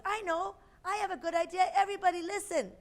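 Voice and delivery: high, eager rabbit voice; bursting with a good idea; excited young rabbit. A narrator's reading. Ralph's idea, voiced character.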